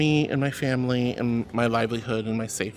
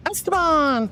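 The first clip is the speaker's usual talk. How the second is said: excited call; 9 semitones higher, slower than usual. Real, not acted. Calling out a name.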